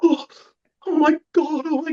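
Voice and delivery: a hammed-up gasp of dismay; Mark's shock. Mark Gardner arrives and sees the spill, high mock panic. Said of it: "He comes down and he's like".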